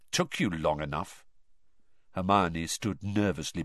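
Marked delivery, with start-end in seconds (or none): 0.1-1.2 low, quiet and cold; coldly. A narrator's reading. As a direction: let the chill show. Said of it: "said Harry coldly".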